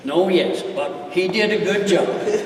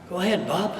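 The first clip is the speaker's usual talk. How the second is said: gentle; 4 semitones lower, 8 dB quieter, faster than usual. Acted. Talking gently to his horse.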